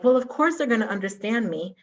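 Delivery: ordinary talk, nothing marked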